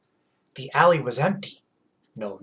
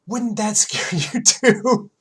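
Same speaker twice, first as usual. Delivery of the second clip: ordinary delivery, nothing marked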